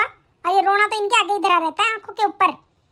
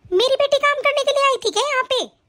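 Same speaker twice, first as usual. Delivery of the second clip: indignant outburst; 6 semitones higher than usual. Acted. Mother indignantly defending daughter.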